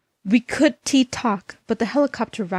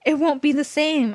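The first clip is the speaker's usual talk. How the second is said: feeling sad; 7 semitones higher than usual. A narrator's reading. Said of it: "I said, feeling sad".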